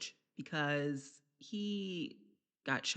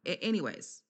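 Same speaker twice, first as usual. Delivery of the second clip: ordinary talk, nothing marked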